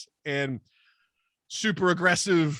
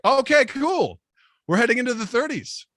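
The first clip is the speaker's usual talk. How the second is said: higher, forced upbeat alarm; nervous cheer; 6 semitones higher than usual. Retold or imitated. Narrator reacting to her age, high and strained. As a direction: fake calm over rising unease. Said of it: "I'm like".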